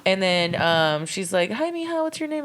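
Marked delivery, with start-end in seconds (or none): 1.5-2.4 sweet stranger. Retold or imitated. Stranger greeting child sweetly.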